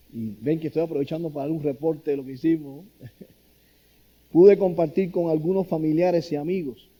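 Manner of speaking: usual speaking voice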